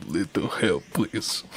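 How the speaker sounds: put-on low, soft Russian accent. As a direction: play a Russian huntress, low and quiet. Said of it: "The Huntress is Russian, right?".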